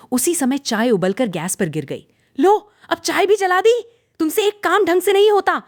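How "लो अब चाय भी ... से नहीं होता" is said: high, loud scolding yell; angry shout. A narrator's reading. Mother-in-law yelling at daughter-in-law over burnt tea, loud and high. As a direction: blow up over the spilled tea. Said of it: "सास की आवाज़ और तेज हो गई".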